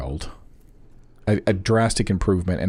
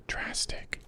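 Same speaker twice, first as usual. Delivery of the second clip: breathy whispered echo of "Drastic"; hushed aside; 15 dB quieter than usual. Real, not acted. Repeats word, then whispers it.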